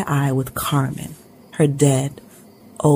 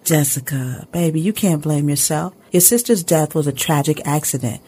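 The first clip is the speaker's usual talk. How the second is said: ordinary delivery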